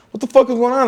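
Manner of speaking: high-pitched, baffled demand; retold bewilderment. Retelling confusion while being cuffed.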